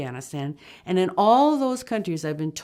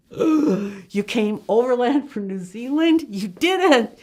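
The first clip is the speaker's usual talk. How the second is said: loud, high, slow-building incredulity; astonished disbelief; 5 semitones higher, 7 dB louder, slower than usual. Retold or imitated. Travelers hearing she came overland from New Zealand, astonished. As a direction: act out being floored by the news.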